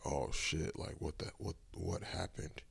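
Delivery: hushed, almost whispered disbelief; calm and quiet. Retold or imitated. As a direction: murmur it calmly to yourself. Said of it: "And still I'm calm".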